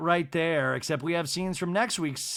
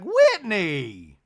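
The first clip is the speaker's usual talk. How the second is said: mock scolding; 6 semitones higher, 8 dB louder than usual. [name] scolding Whitney.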